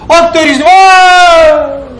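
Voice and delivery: slow, high, loud dramatic outburst; sudden exclamation. Acted. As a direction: drag it out and shout it high for effect. Teacher exclaims during shell counting. (ऊँची, धीमी, ज़ोरदार नाटकीय पुकार)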